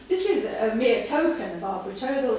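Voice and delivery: usual speaking voice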